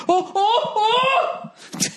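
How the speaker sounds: mock horrified cries. Comedian miming filthy cab seats, laughing high yelps. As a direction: yelp through a laugh as if brushing dirt. Recoiling from expensive clothes.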